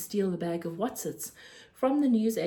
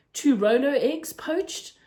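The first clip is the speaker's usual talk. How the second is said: slow, louder, eager host tempting a guest; slow coaxing offer; 6 semitones higher, 6 dB louder, slower than usual. Acted. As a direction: draw it out like a tempting treat. Raj coaxing Joe to eat.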